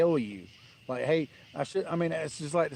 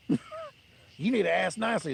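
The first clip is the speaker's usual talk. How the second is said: higher, slow, whiny mock of the neighbor; 7 semitones higher, slower than usual. Mocking the neighbor who took my parking spot, slow and higher. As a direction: drag it out like a sneering parody. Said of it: "And he's like".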